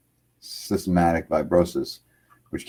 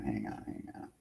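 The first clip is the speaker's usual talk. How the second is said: whispered aside; 9 semitones lower, 19 dB quieter than usual. Real, not acted. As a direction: repeat hang on under the breath, much softer. Talking off-mic while setting up.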